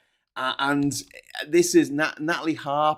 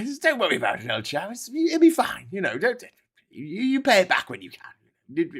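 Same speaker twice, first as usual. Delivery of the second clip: higher, mock American gone old-chap British; British-sounding American accent; 8 semitones higher than usual. Americans waving away Britain's debt, mock British-sounding accent. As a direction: breezily reassure in a botched, very British American accent. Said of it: "my American accent sounds more British than the British".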